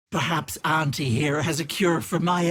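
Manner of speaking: usual speaking voice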